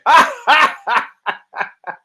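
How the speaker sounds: loud high laugh at his own joke; high cackle. Real, not acted. Comic laughs at his own horse joke, high cackle. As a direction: cackle at your own punchline.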